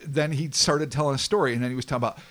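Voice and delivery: ordinary talk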